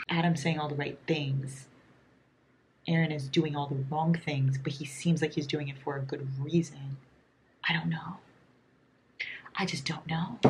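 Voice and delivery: very soft, low musing; hushed. Real, not acted. Late-night reader torn between Adam and Aaron, hushed musing. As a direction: keep it down, thinking aloud.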